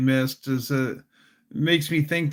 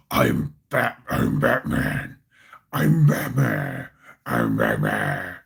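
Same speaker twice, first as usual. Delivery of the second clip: much lower, rasping growl; 14 semitones lower than usual. Retold or imitated. Mocking the Batman-suit voice with a low growl. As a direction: growl it like the caped crusader.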